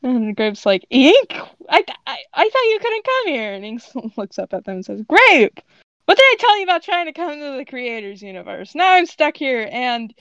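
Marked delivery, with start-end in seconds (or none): 0.9-3.5 high-pitched stammering disbelief; stunned. A narrator's reading. Grape unexpectedly finds Ink here, stunned stammer. 5.0-10.1 loud, raised-pitch telling-off; exasperated scolding. A narrator's reading. Ink scolds Grape over being stuck, exasperated.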